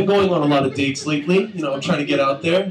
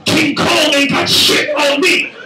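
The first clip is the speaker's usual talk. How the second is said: Denzel quote; 8 semitones higher, slower than usual. Retold or imitated. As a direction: bellow it like Training Day. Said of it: "I started saying".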